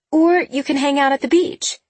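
a hint of an upbeat mom; lightly voiced mother. A narrator's reading. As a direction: suggest it brightly, like a mom.

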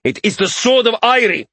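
high, fervent shout. A narrator's reading. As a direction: cry it out like a fanatic king. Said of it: "Priveth shouted".

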